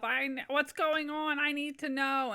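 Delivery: hammed-up, urgent demand; mock demanding mom. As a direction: imitate mom badgering for answers. Acting mom demanding to know.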